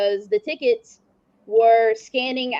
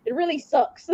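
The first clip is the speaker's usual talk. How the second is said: normal talking voice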